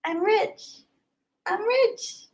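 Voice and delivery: high, soft, playful squeak; mock gloating. Streamer joking after a hundred dollar gift, playful squeak. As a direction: tease that you're rich.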